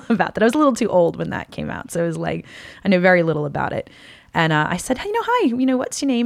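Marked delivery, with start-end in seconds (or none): 5.0-6.2 higher-pitched kindly greeting; imitated kid-friendly hello; talking to a small child.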